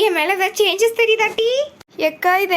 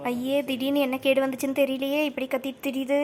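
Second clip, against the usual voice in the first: low, much softer grumble; grumbling aside; 5 semitones lower, 8 dB quieter than usual. Acted. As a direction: complain under your breath about her. Grumbling aside about shouting mother-in-law. (தாழ்ந்த குரலில் முணுமுணுப்பு)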